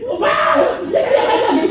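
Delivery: very high, laughing exclamation; mock excited shout. Bully giving up to look for another target, high laughing cry. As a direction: squeal like a bored bully.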